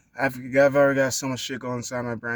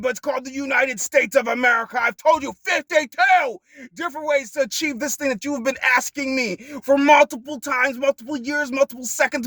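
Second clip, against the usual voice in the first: furious rant; 8 dB louder than usual. Acted. Ranting at someone who never listens, furious loud yell. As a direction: scream it out, fed up and losing patience.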